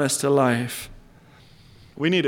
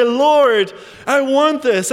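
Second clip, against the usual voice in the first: a bit louder, higher cry to the Lord; eager plea; 7 semitones higher, 4 dB louder, slower than usual. Retold or imitated. Believer answering the sermon, eager higher plea. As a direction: call out eagerly. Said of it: "hear these things and say".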